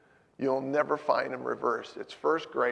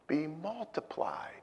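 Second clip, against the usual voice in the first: breathless whisper, barely voiced; whispered prayer; 11 dB quieter than usual. A grieving son whispering his prayer to the Lord, hushed. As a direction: whisper the words to God, pleading.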